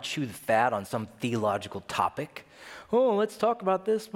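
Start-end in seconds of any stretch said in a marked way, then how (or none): 2.9-4.1 mock chatty.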